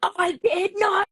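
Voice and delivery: very high, slow, emphatic protest; outraged denial. Retold or imitated. Child blamed for what she didn't do, outraged. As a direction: imitate a kid losing it, each word drawn out. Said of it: "Layla would have lost her mind".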